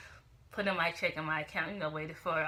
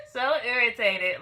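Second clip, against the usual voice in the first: loud, slow, higher-pitched after a laugh; laughing exasperation; 4 semitones higher, 9 dB louder than usual. Real, not acted. Vlogger venting to viewers, laughing exasperation. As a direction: stretch it out with amused irritation.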